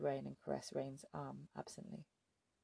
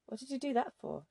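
sharp, high, louder demand; 9 semitones higher, 5 dB louder than usual. A narrator's reading. Captive girl confronting the woman who scratched her, loud and high. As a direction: fire the question back at her. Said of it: "she demanded".

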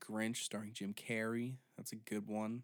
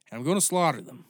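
shouted, much higher mock threat; loud threat; 4 semitones higher, 12 dB louder, faster than usual. Real, not acted. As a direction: yell it like a mad farmer. Drunk ranting about cattle.

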